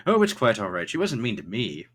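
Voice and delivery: emphatic, reassuring character voice; Thatch. Acted. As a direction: start loud and shrug off the concern. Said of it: "Thatch goes".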